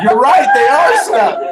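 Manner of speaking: high, loud, laughing; mock-sympathy between laughs; laughing agreement. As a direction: laugh through the words. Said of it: "And I was like".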